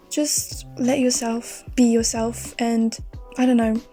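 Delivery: ordinary talk, nothing marked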